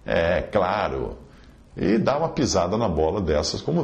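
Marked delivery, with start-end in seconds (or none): 0.0-1.2 slow, low sarcastic aside. Acted. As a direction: drag out a dry "sure". Comentário irônico sobre karma. (sarcástico)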